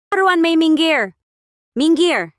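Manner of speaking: high, loud and slow shove-off yell; furious shout. Acted. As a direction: bellow at her to get out of the way. Shoving rival out of the way.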